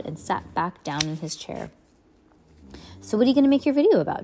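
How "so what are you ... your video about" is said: higher, quick and bright question; upbeat dad voice. Acted. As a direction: ask it fast and high.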